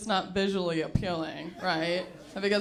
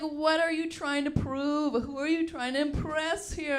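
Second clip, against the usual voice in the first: incredulous rant; 8 semitones higher than usual. Retold or imitated. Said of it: "I was like".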